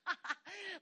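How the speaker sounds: imitated laugh from the throne; acted burst of amused laughter; God laughing. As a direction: laugh as an unbothered king would. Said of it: "I saw him just going".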